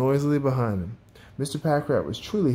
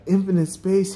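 higher, excited exclamation; awed cry; 6 semitones higher, 3 dB louder than usual. A narrator's reading. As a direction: cry out in wonder. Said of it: "he cried".

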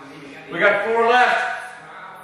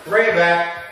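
ordinary talk

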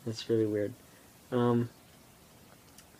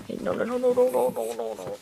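high scolding; 12 semitones higher than usual. Real, not acted. Stopping the dog, high.

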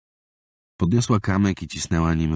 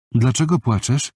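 put-on lifted, higher little-boy voice; a shy six-year-old asking gently; young boy's voice; 8 semitones higher, 4 dB louder than usual. Boy asks crying girl. (głos małego chłopca)

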